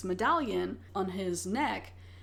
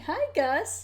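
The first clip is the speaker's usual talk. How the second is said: pet talk; 9 semitones higher than usual. Real, not acted. Podcaster greeting Gus the cat, high pet talk.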